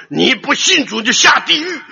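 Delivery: high, loud preacher's warning; hellfire warning. Retold or imitated. Puritan preacher warning unbelievers, loud and high. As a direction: bellow it like a Puritan threatening hell. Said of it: "他们说".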